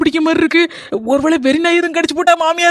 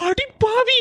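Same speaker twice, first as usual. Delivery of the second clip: mock gasp; 9 semitones higher, 4 dB quieter, much slower than usual. Mock exclamation after sarcastic praise.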